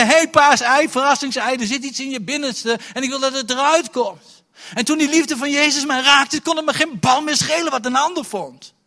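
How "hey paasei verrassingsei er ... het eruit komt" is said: high, loud joyful call; calling Jesus. Retold or imitated. Jesus calling to the teenage preacher, loud and joyful. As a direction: call out eagerly to the hidden treasure.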